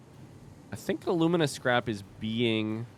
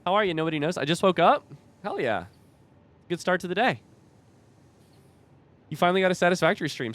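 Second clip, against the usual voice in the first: high bright streamer welcome; excited greeting; 8 semitones higher, 6 dB louder, faster than usual. Real, not acted. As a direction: greet chat loudly, excitedly. Greeting chat, high loud.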